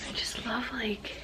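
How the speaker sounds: hushed, breathy admiration; soft unvoiced whisper. Real, not acted. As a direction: whisper it in awe of the book. Hushed admiring of book cover.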